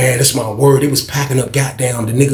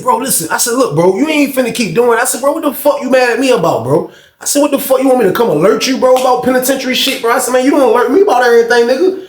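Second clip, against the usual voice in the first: high-pitched, heated protest; indignant acted pushback; acted confrontation; 8 semitones higher than usual. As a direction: retell an argument, pitched well up and insistent. Acted quarrel with Hoop.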